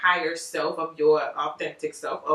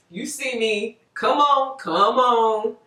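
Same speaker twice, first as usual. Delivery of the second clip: coaxing voice of the mirror self, louder on come on; mirror reflection; 4 semitones higher, 5 dB louder, much slower than usual. Acted. The reflection in the mirror calls to the viewer, coaxing and loud. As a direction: beckon yourself through the glass. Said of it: "your reflection be in there like".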